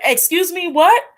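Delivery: high, rising mock shock; shocked what. Retelling Suho, shocked high-pitched disbelief. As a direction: play Suho caught off guard. Suho's shocked reply retold.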